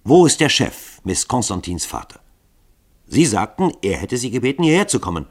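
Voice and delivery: ordinary delivery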